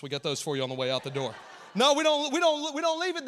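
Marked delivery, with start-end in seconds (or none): 1.7-3.3 loud, higher, laughing; laughing denial. Real, not acted. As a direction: laugh it off as a joke.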